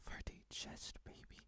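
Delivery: hushed, breathless whisper. Acted. Confessing to the viewer in a secret whisper.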